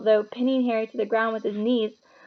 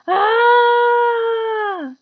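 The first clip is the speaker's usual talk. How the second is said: high pained scream; burned howl; 12 semitones higher, 7 dB louder than usual. A narrator's reading. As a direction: servant screaming as his face burns. Quirrell burned.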